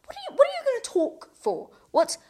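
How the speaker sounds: arguing with herself in disbelief; incredulous self-quote. Retold or imitated. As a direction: jump way up in pitch, scoffing at the idea. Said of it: "And I was like".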